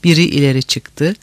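plain reading voice

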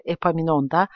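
usual speaking voice

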